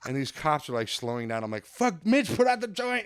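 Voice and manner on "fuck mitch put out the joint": high, loud panicked hiss-turned-yell; panicked stoner yelling at his buddy; mock panic. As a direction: urge your friend before cops see. Retold panic at cops.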